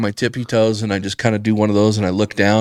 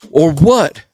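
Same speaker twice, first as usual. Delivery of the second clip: high, louder "or what" snap-back; defiant challenge; 7 semitones higher, 5 dB louder than usual. Retold or imitated. Bum challenging the banker, defiant high retort. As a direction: dare him to do something.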